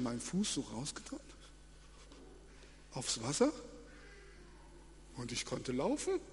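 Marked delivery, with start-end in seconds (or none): none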